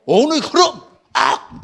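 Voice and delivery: high, loud burst capped with a yell; defiant shout. Acted. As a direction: fire it back as a shouted retort. Acted shouted retort to Yama.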